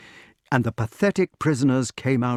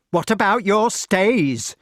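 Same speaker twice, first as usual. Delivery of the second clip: louder, higher, slow taunt; raised, fierce heckle; fierce exclamation; 8 semitones higher, 5 dB louder, slower than usual. A narrator's reading. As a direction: fling the question fiercely. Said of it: "exclaimed the next cell fiercely".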